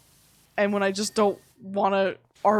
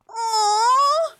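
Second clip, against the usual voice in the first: high, loud, melting aww; touched aww; 7 dB louder than usual. Retold or imitated. Husband moved by the soulmate line, aww. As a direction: coo like it tugged your heart.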